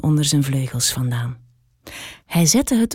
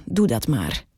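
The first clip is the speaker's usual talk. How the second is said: low, soft groaned words; groaning; 6 semitones lower, 5 dB quieter than usual. A narrator's reading. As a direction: moan it out, worn down by the heat. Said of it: "kreunde de eekhoorn".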